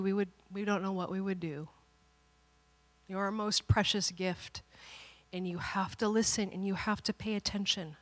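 soft, earnest fatherly talk; tender admonishing. Retold or imitated. Father telling daughter she is precious, softly earnest.

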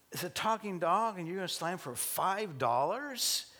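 high-pitched, astonished questioning; acted incredulity. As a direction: challenge the absurd price in disbelief. Guy challenges owner's low price.

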